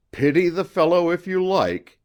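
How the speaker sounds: high, loud and strident professor. A narrator's reading. Professor rebuking the narrator, high, loud and strident. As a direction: bark it out like a lecturing professor. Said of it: "in his rather strident voice".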